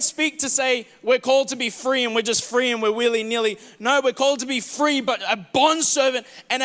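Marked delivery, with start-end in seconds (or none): none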